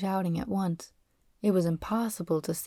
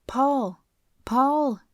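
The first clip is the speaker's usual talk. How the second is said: high, raised calling of a name; panicked shout; 6 semitones higher, 4 dB louder than usual. A narrator's reading. Bunny searching for the other boy, panicked shout. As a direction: call out for a missing friend. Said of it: "he shouted".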